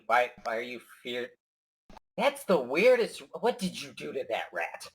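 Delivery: ordinary talk, nothing marked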